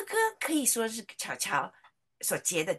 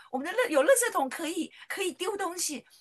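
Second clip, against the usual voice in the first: put-on a higher, childlike prayer voice; child praying; 8 semitones higher, 3 dB louder than usual. Little daughter praying in Sunday school, high childlike voice. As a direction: sound like a little girl praying. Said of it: "他就祷告说".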